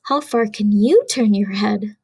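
usual speaking voice

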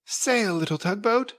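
put-on high, light child's voice; a small boy urging his toy boat on; little boy voice. A narrator's reading. Little boy at the bathtub, high and eager. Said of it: "said the little boy".

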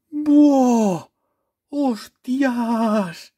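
high, loud yelps of amazement; shocked delight. Real, not acted. Reacting to a new rare card, high amazed yelps. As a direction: blurt it out as the card appears. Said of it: "Me he emocionado".

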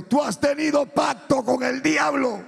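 high, loud, thundering accusation; voice of God. Retold or imitated. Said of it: "Dios me dijo".

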